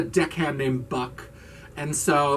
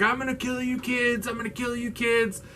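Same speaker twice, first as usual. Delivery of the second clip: high, held-back mock rage; strained imitation of a vengeful threat; mock threatening; 8 semitones higher, 4 dB quieter than usual. Imitating injured Buck threatening the kids, mock threatening.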